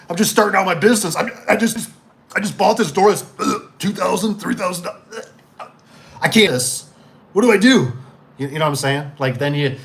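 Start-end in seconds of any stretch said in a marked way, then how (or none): 0.1-7.9 mock panic. Acted panicking new business owner.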